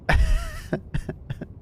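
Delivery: high-pitched burst of laughter; laughing. Real, not acted. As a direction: crack up in a high giggle. Cracks up at own attempts.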